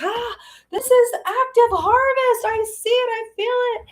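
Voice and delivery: high, gasping burst of excitement; excited realization. Retold or imitated. Telling viewers how she felt active harvest coming, high excited re-enactment. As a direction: act out a thrilled, high-pitched revelation. Acts out her realization moment.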